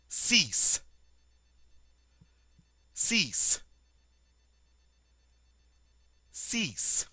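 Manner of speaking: six said as sees, X like an S; French six. Shown as a demonstration. Teacher modeling six for students, clear French. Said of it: "on dit".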